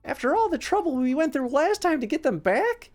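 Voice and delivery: high-pitched disbelief; incredulous young monkey; shocked gasp. A narrator's reading. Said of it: "he gasped".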